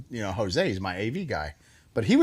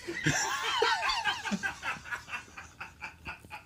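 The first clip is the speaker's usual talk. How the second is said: big laugh; 9 dB quieter than usual. Real, not acted. Host cracking up at his own retold line. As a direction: let out a huge cackle.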